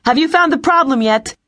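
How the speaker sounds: high, urgent calling question; calling over comm. A narrator's reading. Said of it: "Elise called over the comm".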